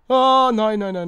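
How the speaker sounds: loud, high oh no; a real loud groan of dismay; dismayed cry. Real, not acted. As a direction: cry out as it goes wrong. Dying in the game.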